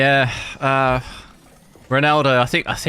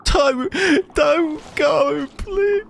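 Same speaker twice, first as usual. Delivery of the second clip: high, whiny fake sobbing; mock crying plea; much slower than usual. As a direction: beg in a pitched-up fake cry. Pleading with character, crying voice.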